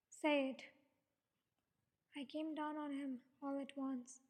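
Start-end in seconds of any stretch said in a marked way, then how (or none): 0.1-0.6 louder, insistent order; confronting demand. A narrator's reading. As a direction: demand it outright. Confronting father, demanding the truth.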